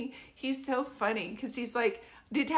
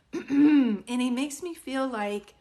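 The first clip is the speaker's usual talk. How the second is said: throat catching; 3 semitones higher, 7 dB louder, slower than usual. Real, not acted. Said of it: "can you pull back on that".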